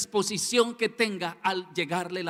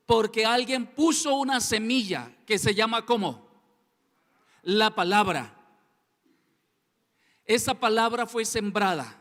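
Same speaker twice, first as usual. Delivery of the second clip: preaching; 5 semitones higher, 6 dB louder than usual. Acted. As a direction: drive the scripture home. Preaching at full force. (predicando con fervor)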